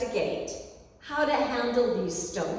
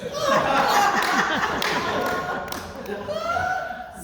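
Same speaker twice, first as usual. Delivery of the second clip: loud high burst of real laughter; big laugh; 3 dB louder than usual. Real, not acted. Speaker laughing at her own golf course joke, big laugh. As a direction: crack up at the punchline.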